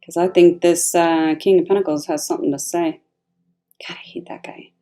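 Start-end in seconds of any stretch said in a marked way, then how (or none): 3.9-4.7 barely audible, whispered grumble; whispered aside. Real, not acted. Reader grumbling about the King of Pentacles, whispered aside. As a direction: whisper it under your breath.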